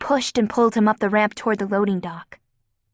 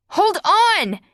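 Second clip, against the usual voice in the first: shout; 11 semitones higher than usual. A narrator's reading. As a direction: yell over the chaos. Fel rising into the air.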